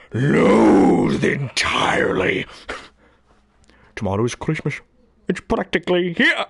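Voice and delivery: hammed-up sneering Grinch. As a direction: Grinch ranting his hatred at the phone book. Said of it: "thing I remember was The Grinch".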